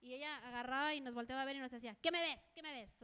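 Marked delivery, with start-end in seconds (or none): 2.0-3.0 mock haughty. Neighbour snapping back at a greeting, mock haughty. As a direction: imitate an arrogant neighbour picking a fight. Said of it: "Así toda bien altanera".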